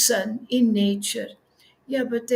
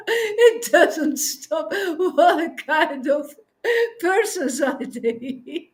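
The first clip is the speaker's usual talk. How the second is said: slow, laughing through the words; 3 semitones higher, slower than usual. Real, not acted. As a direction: let the laughter slow every word.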